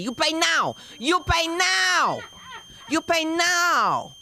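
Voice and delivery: high, drawn-out "you pay now"; demanding nail technician. Retold or imitated. Nail lady demanding extra money for toes, high insistent demand. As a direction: insist high, slowing on each repeat. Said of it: "she must have saw ... and said".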